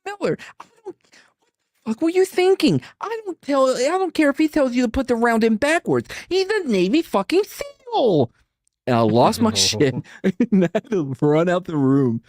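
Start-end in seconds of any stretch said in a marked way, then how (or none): 0.1-8.3 much higher, put-on voice of an outraged officer chewing out Miller; furious officer. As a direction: scold him like a livid boss, high and strained. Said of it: "he goes".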